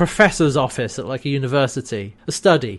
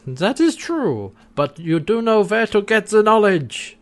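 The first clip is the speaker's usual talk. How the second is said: Harvey; 6 semitones higher than usual. Retold or imitated. Harvey answering John about wormhole knowledge, hammed-up German accent. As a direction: concede slyly in a bad Austrian German accent. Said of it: "like, Austrian German accent".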